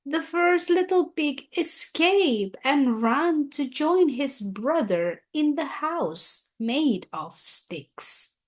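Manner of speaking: plain reading voice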